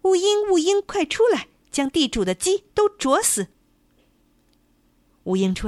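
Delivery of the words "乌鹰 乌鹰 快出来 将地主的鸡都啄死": high, loud urgent call. A narrator's reading. Rooster in the chicken coop calling the hawk, high loud call. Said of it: "公鸡叫道".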